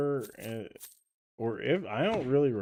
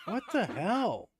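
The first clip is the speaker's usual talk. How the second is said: surprised; 9 semitones higher, slower than usual. Real, not acted. Boss does something weird, silent.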